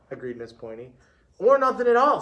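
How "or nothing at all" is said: higher-pitched pupil calling out an answer; pupil chiming in. A narrator's reading. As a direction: chime in with a higher, lifted voice.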